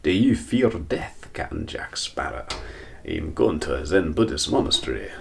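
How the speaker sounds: a hammed-up Scottish accent that keeps slipping; bad Scottish accent. Quoting a film line in a faltering Scottish accent. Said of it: "terrible impression".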